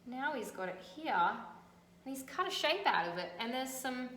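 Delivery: plain reading voice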